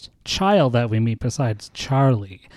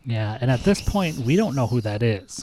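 ordinary delivery

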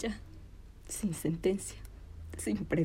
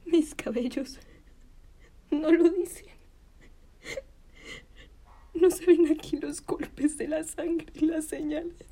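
raised, higher-pitched outraged appeal; pained theatrical outcry; anguished reproach; 6 semitones higher than usual. Acted. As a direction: plead your injuries in indignant anguish. Displays her wounds to the men.

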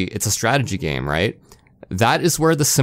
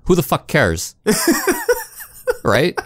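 laughing; 7 semitones higher, slower than usual. Real, not acted. Laughing while ranting.

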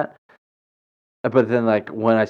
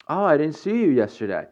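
higher, mock-sweet passive-aggressive imitation; 6 semitones higher than usual. Church acquaintance needling her for skipping church, mock passive-aggressive. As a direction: imitate a churchgoer's pointed, sugary remark. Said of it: "Passive aggressiveness".

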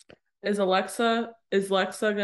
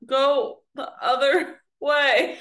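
high, loud, drawn-out plea; mock calling out; 6 semitones higher, 6 dB louder, slower than usual. Calling the friend in limbo back from the light, mock plea. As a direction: call slowly to someone drifting away, hammed up.

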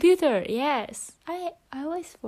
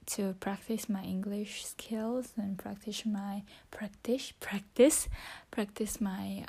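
whispering. Real, not acted. Whispering her English practice into the phone. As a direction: keep it to a whisper throughout.

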